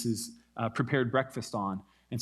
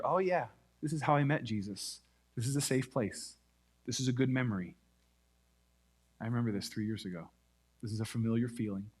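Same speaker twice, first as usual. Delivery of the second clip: soft inner thought; 3 semitones lower, 6 dB quieter than usual. Retold or imitated. Peter's imagined inner thought.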